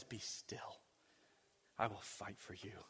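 soft, low whispered reassurance; quiet whisper. Acted. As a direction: whisper it gently, low and calm. God's quiet answer.

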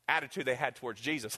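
ordinary talk

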